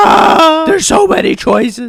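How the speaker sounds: put-on high, loud gasp of a wowed child; amazed kid. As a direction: sound like a kid overwhelmed by choices. Mind-blown kid voiced.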